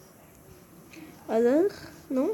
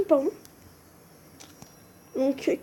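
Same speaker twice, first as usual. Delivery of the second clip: usual speaking voice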